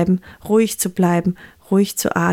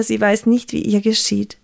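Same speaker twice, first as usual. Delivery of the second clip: ordinary delivery, nothing marked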